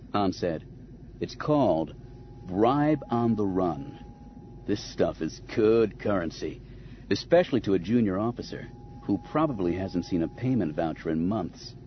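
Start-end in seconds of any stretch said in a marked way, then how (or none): 1.2-11.7 low, unhurried smuggler voice; wry smuggler Han. Acted. Han explaining his bribe to Leia, low and wry. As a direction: lay out the scheme coolly, pitched low.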